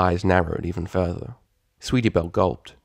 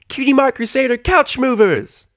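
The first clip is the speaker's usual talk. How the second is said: loud, high triumphant filly cry; rally the team with a cheer; excited cheer; 6 dB louder than usual. Acted. Fillies naming their new team, loud and high.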